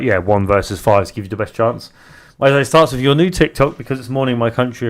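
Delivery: ordinary talk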